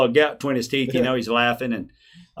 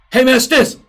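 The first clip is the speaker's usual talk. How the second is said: loud, higher booming impression of a friend on the phone; Stinson's voice; 11 semitones higher, 10 dB louder than usual. Retired player calls narrator, loud booming imitation. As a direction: boom it out like the big ex-player calling. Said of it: "That's how he talks".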